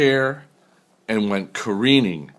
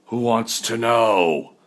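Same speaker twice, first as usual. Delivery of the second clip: growl. A narrator's reading. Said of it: "growled a voice from inside".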